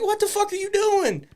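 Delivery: high-pitched incredulous demand; mock shriek of disbelief at an opponent; retold exasperation. Wrestler confronts no-selling opponent, high incredulous retort. Retelling own exasperated shout.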